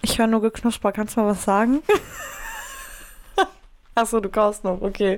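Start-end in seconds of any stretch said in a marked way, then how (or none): 1.9-2.8 very high squeal of laughter. Real, not acted. Lacht, weil Freundin kaut. (quietschendes Lachen)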